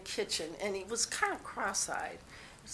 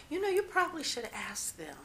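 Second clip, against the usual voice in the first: higher-pitched acted-out brush-off; pointed suggestion, pitched up then settling; retold polite refusal; 4 semitones higher, 3 dB quieter, faster than usual. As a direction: politely decline to inform, starting high and easing down.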